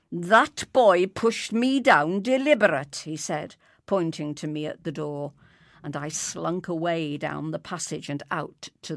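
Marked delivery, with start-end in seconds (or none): 0.1-3.0 loud, aggrieved accusation; hissing indignation. A narrator's reading. Mister Weasley accusing the boy of pushing him, indignant. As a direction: spit out a loud complaint. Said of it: "hissing like a gander".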